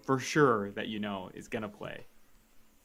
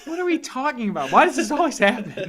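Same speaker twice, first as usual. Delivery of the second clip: baffled; 12 semitones higher, 11 dB louder than usual. Real, not acted. Baffled by wrestling topic.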